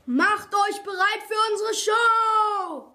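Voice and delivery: slow, high show-host roar; bellowed announcement. A narrator's reading. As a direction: bellow it into the mic, drawing out the words. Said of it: "brüllte der glatzköpfige Zoodirektor". (langgezogen ins Mikro gebrüllt)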